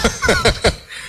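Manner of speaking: a quick amused laugh; brief chuckle at the joke; short laugh. Real, not acted. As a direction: laugh at the punchline. Preacher laughs at own joke.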